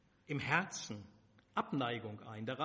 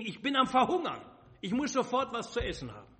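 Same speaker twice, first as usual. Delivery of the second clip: higher, louder call of a starving hunter; 6 semitones higher, 4 dB louder, faster than usual. Acted. Esau back from the hunt calls to his brother, loud and hungry. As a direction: shout it across, laughing, famished. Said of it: "rief mir entgegen".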